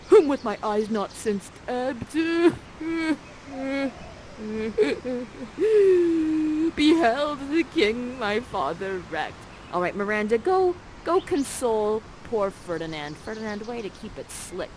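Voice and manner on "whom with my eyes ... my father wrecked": hammed-up tearful lament; mock crying. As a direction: break into fake sobs mid-speech, drawing words out. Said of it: "crying on your first date".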